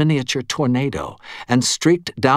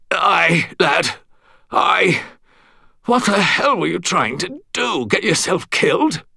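put-on scolding old Englishman; 8 semitones higher than usual. A narrator's reading. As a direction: sputter and scold like an old soldier. Stuart scolding the narrator.

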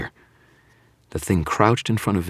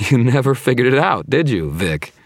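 goading; 9 semitones higher, 6 dB louder than usual. A narrator's reading. Hero goads the villain.

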